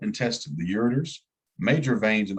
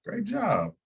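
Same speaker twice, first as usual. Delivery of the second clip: put-on much higher, bright dad praise to his kid; dad praising his daughter, much higher; mock praise to a toddler; 12 semitones higher than usual. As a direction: pitch it way up like cheering a toddler. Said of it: "I was like".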